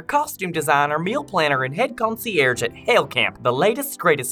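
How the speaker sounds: loud, bright commercial announcer; ad read. Acted. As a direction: sell it like a radio ad. Ad read over music.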